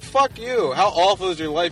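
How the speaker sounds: high, loud comic indignation; mock outraged retort. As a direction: throw it back at them, loud and incredulous. Acted outburst at a complainer.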